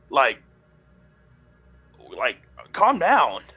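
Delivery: high-pitched, exasperated backing down; alarmed protest. Acted. Man on his back answers a death threat, alarmed protest.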